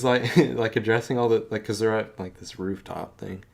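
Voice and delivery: usual speaking voice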